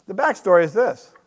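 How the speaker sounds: ordinary talk, nothing marked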